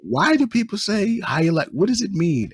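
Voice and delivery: incredulous, high and puzzled; mock-baffled self asking where the phrase came from. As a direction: ask it like it makes no sense at all. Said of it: "I was like".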